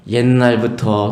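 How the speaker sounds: drawn out slowly, 옛날부터 stretched as a calm lead-in; slow roundabout opening. Shown as a demonstration. The wise woman opening to Joab slowly with 옛날부터. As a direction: ease into it the long way round. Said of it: "쫙 돌려서 얘기하는 거예요".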